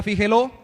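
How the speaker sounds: "fíjelo" as fi-je-LÓ, last syllable loud; stress on last syllable. Shown as a demonstration. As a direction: punch the final O of "fíjelo". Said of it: "tendría tilde en la O".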